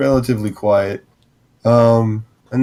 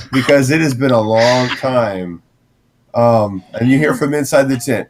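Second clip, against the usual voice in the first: ordinary talk, nothing marked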